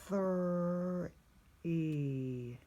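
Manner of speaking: "thr-" then "E" said apart; sounding out. Shown as a demonstration. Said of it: "The next word I'm gonna sound out".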